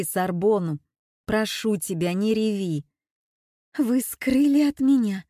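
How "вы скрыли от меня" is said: tearful reproach, pitch up and louder; сквозь слёзы. A narrator's reading. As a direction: reproach her through tears. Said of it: "сквозь слёзы упрекает Маруся". (упрёк сквозь слёзы)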